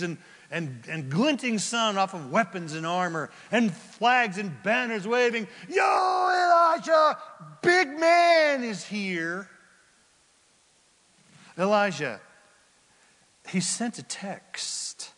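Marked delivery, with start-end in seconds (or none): 5.7-9.5 high, slow, shouted announcement; mock herald shout. Herald calling Elisha out, a slow high shout. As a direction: bellow it out like a proud herald at the gate.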